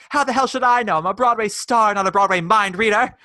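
loud, high diva snap with a laugh; imitating Judy. Judy snapping at Tina, loud high diva voice. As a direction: belt it like an outraged Broadway star. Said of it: "And Judy goes".

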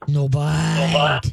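very slow, low "ah, no bite"; low slow command. Shown as a demonstration. Trainer showing the owner a low, slow correction. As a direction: drag out "no bite" low and firm. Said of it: "The lower and slower and deeper your voice is".

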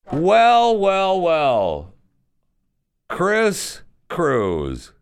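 lightly hammed-up villain greeting to a latecomer; teasing greeting. Host greets arriving co-host teasingly.

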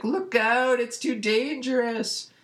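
high-pitched cartoon alarm, dragged out; mock-frightened squeal of warning; panicked warning. Beast Boy warns the terrified Teen Titans, mock panic.

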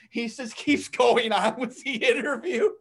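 words breaking into real laughter; laughing. Real, not acted. Recounting the newscaster carrying on live, laughing. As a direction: laugh right through the line.

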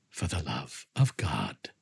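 mock him quietly and slowly; cold mocking echo. Montresor coldly echoes cry.

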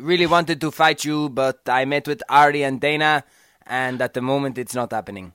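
a hammed-up, bad French-Canadian accent; terrible George Saint Pierre accent. Cohost mocking the fighter's video in a bad French-Canadian accent. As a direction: lightly fake a French-Canadian accent for a mock video message. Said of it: "That, that was a terrible accent".

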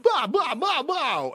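high, loud Hanks blather; Tom Hanks yelling. Retold or imitated. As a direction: yell the blahs like Hanks at the plane. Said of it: "that's how I was able to learn it".

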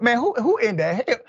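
venting in disbelief, voice shooting up; outraged. Real, not acted. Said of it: "I just needed to say that, get it off my chest".

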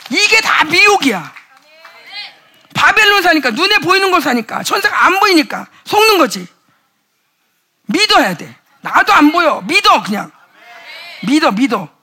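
loud, high fervent preaching; preaching at full force. Real, not acted. Preacher rousing the congregation, loud fervent preaching. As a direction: pound it out to the congregation.

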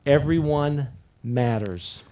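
slow, deliberate, each word weighed; slow emphatic line. Shown as a demonstration. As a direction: lay it down slowly so it sinks in. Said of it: "Repeat after me".

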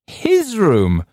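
high, loud mocking shriek; woman on the right shrieking in derision. A narrator's reading. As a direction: shriek it back at him in scorn. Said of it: "shrieked the woman".

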